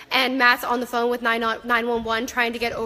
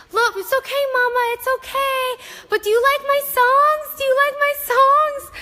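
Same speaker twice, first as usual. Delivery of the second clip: put-on high, sweet little-girl voice; toddler voice; 12 semitones higher, 3 dB louder than usual. Toddler singing Elsa songs to her laboring mom, high and sweet. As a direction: coo it like a small child seeking praise. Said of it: "And she kept saying".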